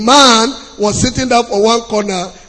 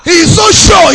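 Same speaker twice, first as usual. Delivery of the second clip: shout; 7 semitones higher, 5 dB louder than usual. Acted. Preacher shouts the point.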